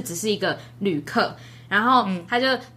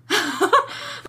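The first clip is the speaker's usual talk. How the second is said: rising giggle; 8 semitones higher than usual. Real, not acted. Laughing at own story.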